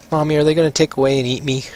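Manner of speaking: put-on childlike, mildly fearful question; scared little boy. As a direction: ask Mommy like a worried kid. Frightened boy asks his mother.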